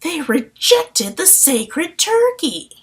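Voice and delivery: slow, high, loud delighted repeat; excited. Retold or imitated. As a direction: savor every word with glee. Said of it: "I love that part".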